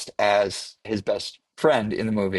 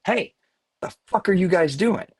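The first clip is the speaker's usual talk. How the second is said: angry bar owner; 10 semitones higher than usual. Retold or imitated. Bar owner scolding actors drinking his whiskey, mock angry. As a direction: imitate the owner snapping at the drinkers.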